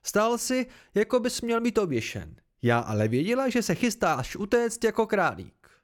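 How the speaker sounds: high, lively gloating; triumphant accusation. A narrator's reading. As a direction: crow over being proved right. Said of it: "vykřikla vítězně".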